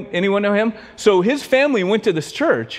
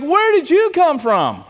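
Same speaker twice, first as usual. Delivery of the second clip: high, slow, drawn-out amazement; astonished question; 10 semitones higher, slower than usual. Retold or imitated. Woman to man who hopped the wall, astonished. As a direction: stretch out the question in retold disbelief.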